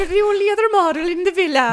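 loud, brisk Welsh lilt sliding Scandinavian; wobbly Welsh accent. Acted. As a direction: attempt Welsh and drift toward Scandinavian. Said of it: "my Welsh accent just turned into Scandinavian".

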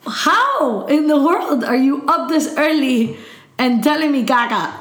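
disbelieving outburst. Retold or imitated. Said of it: "And I'm like".

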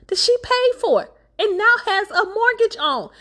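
high, loud indignation; outraged disbelief. Real, not acted. Storyteller scolding the writer over the plot, high loud outrage. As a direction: climb into an indignant squeal.

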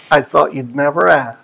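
low, soft relieved reply. Retold or imitated. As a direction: sigh it out with relief. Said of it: "Relieved, he said".

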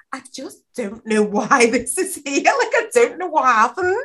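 high-pitched feigned bafflement; mock innocence. Killer standing over a body with bloody knife, mock-innocent.

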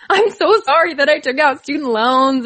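imitating a weepy apology, voice lifted; mock weepy. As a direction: lightly act out a teary apology. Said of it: "I just get all, like, weepy".

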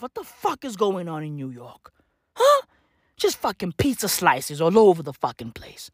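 usual speaking voice

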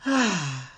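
big open sigh, letting the shoulders drop; loud audible sigh. Shown as a demonstration. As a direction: release it all in one loud exhale. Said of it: "And drop them with a loud sigh on the out-breath".

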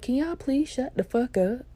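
high, pressing retold plea; exasperated plea. Embarrassed student hushes friends behind her, high exasperation.

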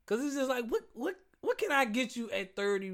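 genuinely stumped, rising pitch; baffled, high voice. Real, not acted. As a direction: pitch jumps up in disbelief. Baffled over a gift.

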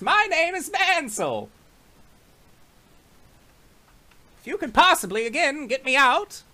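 high pleading prisoner; satyr voice. Acted. Satyr introduces himself.

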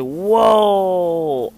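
stunned wonder; blown away. Retold or imitated. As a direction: let the whoa ring with amazement.

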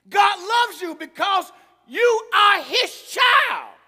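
loud, fervent preacher calling out to the congregation; preaching at full force. Acted. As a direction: preach it hard, rising to a shout.

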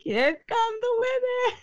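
high hammed-up announcer call; mock announcer yell. Repeating the joke to her friend, high mock yell. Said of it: "and then I'm like".

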